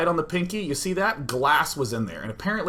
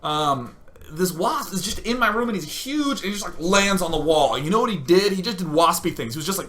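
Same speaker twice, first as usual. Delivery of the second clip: usual speaking voice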